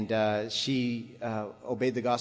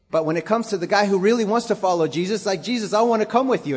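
rising, louder and faster plea to follow; a would-be follower pleading, louder and quicker; eager plea; 5 semitones higher, 4 dB louder, faster than usual. Acted. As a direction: push it up with urgent longing. Preacher building to eager plea.

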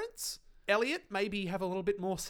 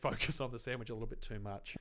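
usual speaking voice

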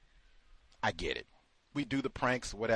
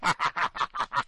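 imitated cackling of the pranksters; put-on giggling fit; mock laughter. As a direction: laugh in mockery, a long rattling run. Mimics staff laughing at prank.

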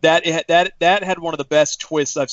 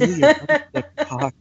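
high, laughing sultry purr; phone sex operator voice; 12 semitones higher than usual. Pretend. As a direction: seductive mock moan. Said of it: "that's like phone sex operator".

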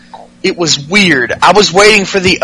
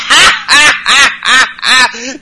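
real laugh; 5 semitones higher, slower than usual. Real, not acted. Laughing at own joke.

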